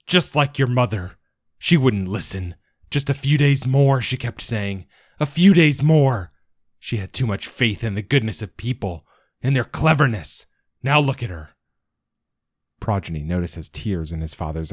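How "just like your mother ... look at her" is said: furious grief. Acted. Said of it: "he screams".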